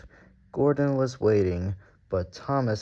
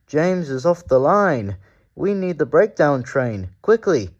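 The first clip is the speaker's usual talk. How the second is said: higher, louder urgent call; shouting; 6 semitones higher, 7 dB louder than usual. A narrator's reading. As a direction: call out the alarm. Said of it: "he shouted".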